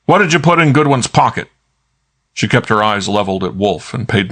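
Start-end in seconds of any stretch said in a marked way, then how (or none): 0.0-1.5 barking. A narrator's reading. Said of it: "Kramer barked at Naya".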